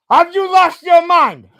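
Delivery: loud, high, exasperated outburst; outraged rant. Real, not acted. Streamer yelling at chat over a comment, loud outraged disbelief. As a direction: blast the question at chat in disbelief.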